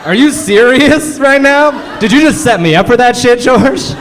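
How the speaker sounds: high, loud, slow indignant shout; mock outraged shout. Teacher yelling at George for the setup, mock outraged shout. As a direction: bellow it like you've been betrayed.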